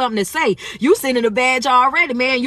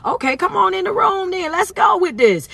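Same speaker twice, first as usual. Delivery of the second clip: higher-pitched, rallying call to the room; 10 semitones higher than usual. Real, not acted. Host urging viewers into the live room, calling out. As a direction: call everyone in on a raised, sustained pitch.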